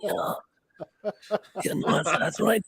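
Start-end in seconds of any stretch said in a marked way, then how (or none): 0.0-0.3 hoarse, soft, breathy whisper; raspy whisper. Retold or imitated. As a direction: rasp it like a worn-out smoker. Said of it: "he talks like this".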